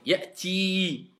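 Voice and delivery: Ya'ti ending on a sustained ee; long vowel. Shown as a demonstration. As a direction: hold the last vowel of Ya'ti. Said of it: "C'est une voyelle longue".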